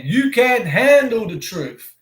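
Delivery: high, drawn-out courtroom outburst; hammed-up film quote, stretching the words; Jack Nicholson. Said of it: "as Jack Nicholson said to Tom Cruise".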